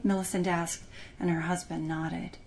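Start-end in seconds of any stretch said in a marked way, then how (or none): none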